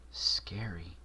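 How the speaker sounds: a shivering whispered word. Acted. Twilight reacting to Marshall's grim quote, whispered. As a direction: breathe it out as a scared whisper.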